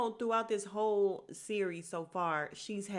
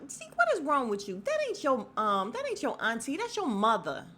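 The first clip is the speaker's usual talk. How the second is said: high, loud imitation of exasperation; mock-indignant outburst, punchy and raised; exasperated retort; 6 semitones higher, 5 dB louder than usual. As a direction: act out setting someone straight, pitched up. Voicing Diana's exasperated big reveal.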